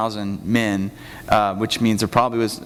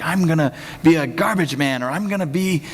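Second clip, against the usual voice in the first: imitating an eager child; 6 semitones higher than usual. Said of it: "Kinda like your grandma".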